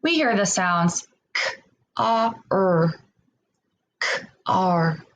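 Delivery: k, a, er said as separate sounds; sound-by-sound segmenting. Shown as a demonstration. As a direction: break car into separate sounds. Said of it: "let's segment that word".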